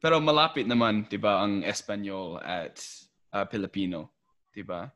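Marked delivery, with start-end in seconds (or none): none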